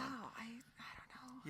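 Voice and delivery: bashful whisper. Real, not acted. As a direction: whisper it, slow and shy. Bashful after compliment.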